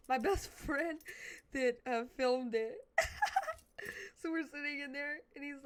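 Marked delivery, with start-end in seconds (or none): 0.1-3.1 laughing while telling it. Real, not acted. Speaker laughing about the friend who filmed it, real laugh.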